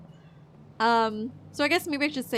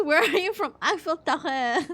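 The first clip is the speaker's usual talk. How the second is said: high, loud silly tourist voice; mock tourist; 6 semitones higher, 5 dB louder than usual. Gamer jokes about remembering no French, silly tourist voice. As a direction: play a clueless tourist.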